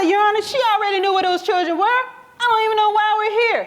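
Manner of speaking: high, fast, aggrieved complaint; indignant protest. Retold or imitated. Ex-husband protesting to the judge, high and indignant. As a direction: rush it out, outraged at the judge.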